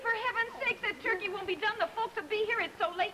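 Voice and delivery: high, agitated fussing; frantic scolding. Acted. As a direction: scold him in a high-pitched fluster. Scolds him over late turkey.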